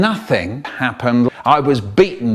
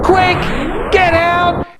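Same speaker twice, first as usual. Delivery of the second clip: very high, loud squeak; funny urgent voice; 4 dB louder, slower than usual. Acted. Funny voice urges escape from bombs.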